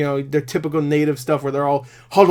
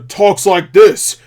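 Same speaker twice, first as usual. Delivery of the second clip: lightly put-on stern voice, slow and loud; hammed-up stern delivery, drawn out; stern character; 3 semitones higher, 6 dB louder, much slower than usual. Shown as a demonstration. Reviewer showing the movie's stock voices, stern character. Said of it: "maybe a stern character".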